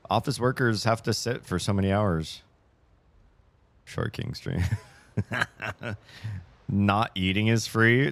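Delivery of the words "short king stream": low, dry repeat with a small rise; deadpan repeat. Pretend. Repeats joke title.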